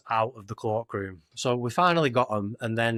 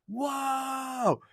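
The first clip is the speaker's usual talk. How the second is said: high, drawn-out mock whoa; awed crowd reaction; 13 semitones higher, 6 dB quieter than usual. Festival queue seeing new artwork, high-pitched hyped awe. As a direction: imitate an amazed crowd, high and long.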